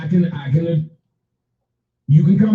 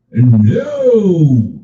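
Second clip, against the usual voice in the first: a loud, drawn-out no; emphatic no; 4 semitones lower, 6 dB louder than usual. Real, not acted. Streamer loudly refuses to kick out paying hecklers. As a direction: shut the idea down loudly.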